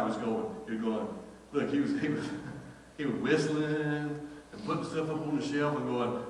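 ordinary delivery

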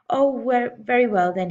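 loud, grudging snap; snappy, ill-tempered concession; snapped. A narrator's reading. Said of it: "he snapped".

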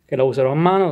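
usual speaking voice

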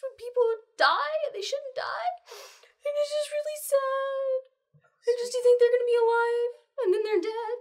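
very high, loud tearful wail; distraught girl wailing between sobs; hysterical crying. Acted. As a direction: sob it all out in a high voice. Hester sobbing hysterically to Anne.

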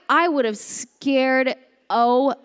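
ordinary delivery

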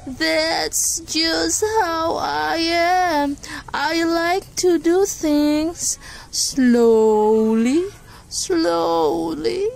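drawn-out sleepy sloth; slow sloth voice. Acted. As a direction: stretch each slowly out. Sloth repeats slowly, drawn out.

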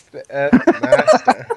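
slow, high and loud, hammed-up pride; mock grand title. Real, not acted. Repeating friend's praise back, in hammed-up grandeur. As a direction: relish the title like a crown. Savouring the title just given.

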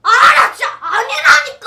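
high, loud, near-tearful denial; frustrated shout. Acted. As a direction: scream it, fed up.

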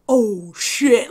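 mock slow-motion exclamation. Bystander realising she's coming after him, slow-motion "oh, shit". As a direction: stretch each word as if in slow motion. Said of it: "like, low, slow motion".

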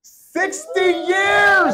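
high, slow, drawn-out shout of sixty years. Real, not acted. Pastor calling out how long the grandparents were married, slow high shout. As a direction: stretch it out and call it loud and high.

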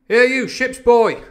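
slow, high, loud captain's call; crying out. A narrator's reading. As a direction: hail the boy across the deck. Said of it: "he cried".

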